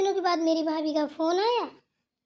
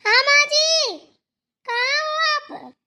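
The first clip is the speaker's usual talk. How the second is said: high, stretched answering call; loud calling out; 9 semitones higher, 7 dB louder, slower than usual. Acted. Daughter-in-law asking where mother-in-law is, loud calling out. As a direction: call across a distance, drawing out each phrase. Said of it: "इतनी तेज तेज बोल रहे थे".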